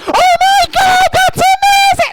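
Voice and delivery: very high, loud, drawn-out squeal; hammed-up gushing shriek, stretched out slowly; mock overreaction. Imitating her reaction to his dad being in the band, over-the-top gushing. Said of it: "completely overreacted".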